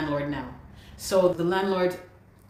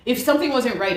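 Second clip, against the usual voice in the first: retold indignant outburst; 7 semitones higher, 6 dB louder than usual. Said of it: "I'm like".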